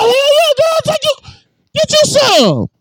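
high, excited hell yeah; mock eager yes. Mocking their eager yes to the woman, high and excited. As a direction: squeal it like a thrilled friend.

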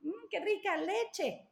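put-on high, cheerful repeat of leche to a toddler; cheerful modelling. Shown as a demonstration. Mother to toddler asking for milk, high and cheerful. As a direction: sing out leche happily, no lesson.

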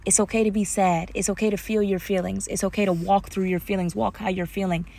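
soft, soothing reassurance; comforting. Acted. Imagined parent comforting a crying child, soft reassurance. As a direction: gently give permission to cry, voice dropped soft. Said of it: "have the comfort of somebody saying".

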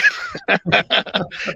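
high-pitched chuckle at himself; real laugh. Real, not acted. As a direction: laugh freely at your own bravado. Laughing at own reckless bravado.

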